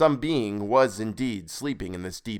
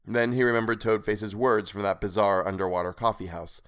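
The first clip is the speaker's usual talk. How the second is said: usual speaking voice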